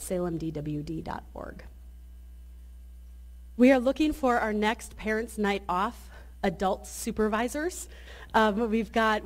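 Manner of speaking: ordinary talk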